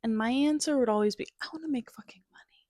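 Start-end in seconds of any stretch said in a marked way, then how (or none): 1.4-2.6 unvoiced whisper, quick and cheeky; whispered aside. Answering her professor with a blunt whispered confession. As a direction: let the crude truth slip out in a whisper. Blurting true class intro answer.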